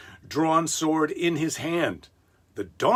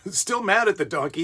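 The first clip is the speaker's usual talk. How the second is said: ordinary talk, nothing marked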